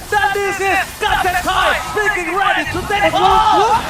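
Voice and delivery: slow, loud, high hype call; hype announcer call. Acted. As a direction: stretch it out like a big esports caster.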